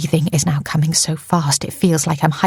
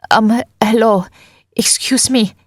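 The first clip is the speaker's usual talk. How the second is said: nervous, higher-pitched call for attention; breathless, high-pitched; 5 semitones higher than usual. A narrator's reading. Nika stalling Phil at his front door, breathless and high. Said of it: "She's breathless, too high-pitched".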